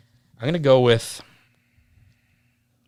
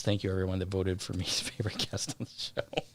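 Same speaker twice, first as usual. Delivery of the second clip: whispered quote; 3 semitones lower, 10 dB quieter, faster than usual. Retold or imitated. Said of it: "John says".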